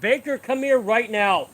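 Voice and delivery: loud, high command; firm shout at the dog; stern call to the dog. Real, not acted. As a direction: order the dog in, no nonsense.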